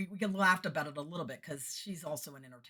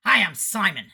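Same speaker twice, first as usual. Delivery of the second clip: loud, higher acted greeting; booming introduction; 4 semitones higher, 15 dB louder than usual. Sound guy introducing himself to the singer, booming. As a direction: announce yourself as the brisk sound guy.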